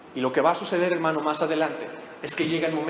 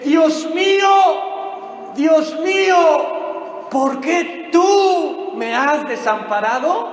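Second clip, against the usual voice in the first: very slow, high, loud desperate shout; anguished shout; 10 semitones higher, 8 dB louder, much slower than usual. Acted. Preacher acting Jesus forsaken, shouting slow and high. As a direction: wail it to the heavens. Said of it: "Y dice".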